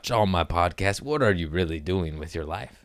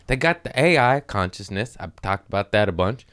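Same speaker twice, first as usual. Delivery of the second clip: ordinary talk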